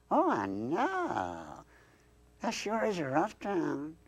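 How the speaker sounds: slow, swooping Southern drawl; high-pitched, stretched Southern puppet greeting; Southern accent. Acted. Southern dummy greets new acquaintance, slow Southern drawl. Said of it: "that accent".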